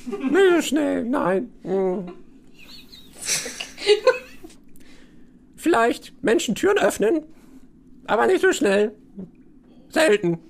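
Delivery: high-pitched, halting little rat. Acted. Rat answering prisoner about escape, halting high creature voice. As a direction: answer in short drawn-out bursts as a talking rat. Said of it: "kommt so eine Ratte angelaufen".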